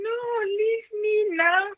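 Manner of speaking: high, slow, coy protest; half-hearted no. Shown as a demonstration. Girl brushing off a boy touching her, high and coy. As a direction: say no while meaning yes, drawn out and teasing. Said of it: "not really saying it meaningly from your heart".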